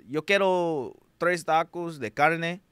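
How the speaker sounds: put-on careful Spanish-accented order, showing off. Showing off his Spanish to the taco truck staff, in a Spanish accent. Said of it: "I hit 'em with a".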